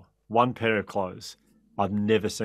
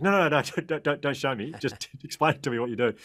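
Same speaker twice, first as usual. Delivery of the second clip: high, laughing no no no; giggling horrified pleading; laughing protest; 6 semitones higher than usual. Retold or imitated. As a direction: laugh through the refusal in disbelief. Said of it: "I was like".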